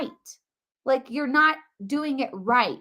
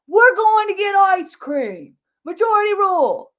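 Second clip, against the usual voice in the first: mock crowd; 8 semitones higher, 7 dB louder than usual. Voicing the majority crowd.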